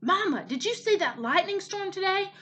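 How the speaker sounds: put-on high, excited child's question; eager young gosling. A narrator's reading. Gosling asks his mother about the storm, excitedly. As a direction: pipe up high to Mama.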